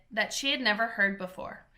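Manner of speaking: ordinary delivery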